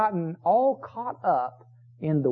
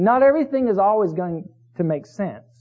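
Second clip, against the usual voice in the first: ordinary talk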